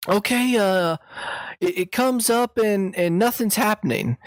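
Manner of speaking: high-pitched granny fumbling with her computer; Southern old lady. Acted. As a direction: play dumb like a sweet old grandma. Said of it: "pretended like I was a, just a Southern idiot".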